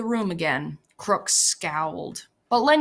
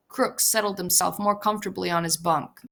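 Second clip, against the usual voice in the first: ordinary delivery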